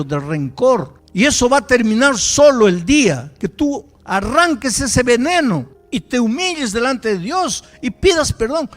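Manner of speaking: loud, high, fiery sermon rebuke; a pastor scolds a bitter young man, booming; preaching at full force. Acted. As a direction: hammer the warning home like a preacher in full flow.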